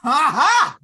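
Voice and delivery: a loud, gleeful burst of laughter; triumphant laugh. Acted. Man laughing in triumph at the logic's answer. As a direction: crow with delight at the news.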